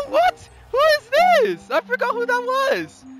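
squeaky, incredulous questioning; high, amused bafflement; high-pitched disbelief. Real, not acted. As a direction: ask it in a very high voice. Mock disbelief at own play.

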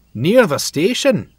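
loud, high, playful Scots reply; grinning. A narrator's reading. Donald playing along with Bear, loud and grinning. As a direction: call it out with a knowing grin. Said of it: "replied with a grin".

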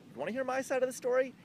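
high, loud, fast question; exaggerated high-pitched pleading; retold eager plea. Retelling own plea to police.

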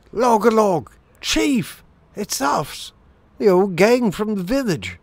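high, excited shrew cries; shouting shrews. A narrator's reading. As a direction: hail your chief in a high, eager voice. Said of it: "a great shout went up".

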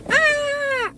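high excited squeal. Real, not acted. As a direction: squeal at the surprise. Opening package excitedly.

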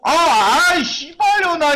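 high, loud angry outburst; frustrated curse. Real, not acted. Streamer swearing at a failed upgrade. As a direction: vent at the game. Enhancement fails again.

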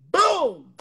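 loud, punchy exclamation; emphatic boom. Real, not acted. Guest caps her success story with a loud boom. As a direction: slam the word down.

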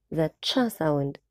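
"cha" said slowly with a clear ch; cha sound. Shown as a demonstration. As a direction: stretch out the "cha" sound so the class can copy it. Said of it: "السي اتش بنطقها cha". (نطق "cha" ببطء وبوضوح)

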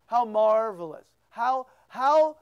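ordinary talk

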